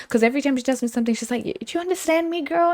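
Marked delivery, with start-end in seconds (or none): 1.4-2.7 high-pitched quoted question; high, girly mock voice; imitated friend. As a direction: play the friend asking, pitched way up. Acting out friend's question to her.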